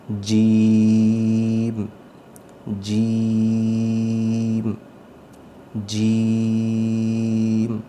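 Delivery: جیم with the vowel held very long; lengthened jeem. Shown as a demonstration. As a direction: stretch the middle of جیم. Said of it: "لمبا کر کے پڑھیں گے". (جیم مد کے ساتھ لمبا)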